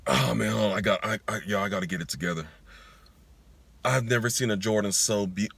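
a half-joking teary moment over sneakers; emotional. Real, not acted. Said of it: "my bad for showing that emotional moment".

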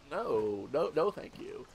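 soft, low dismissive brush-off; mock polite refusal. Gamer declining a free key scam, mock polite refusal. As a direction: turn it down quietly, voice dropped.

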